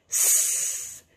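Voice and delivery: S as a long voiceless hiss; phonics sound demo. Shown as a demonstration. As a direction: hold the sound of "S" as pure sss. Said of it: "first sound you can hear".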